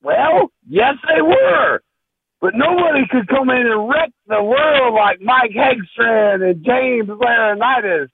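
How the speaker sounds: high, hammed-up wrestler growl; wrestling promo voice. Caller hams up a wrestling promo for the host, in a high hammed-up voice.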